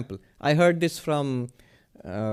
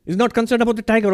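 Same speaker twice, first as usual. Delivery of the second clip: usual speaking voice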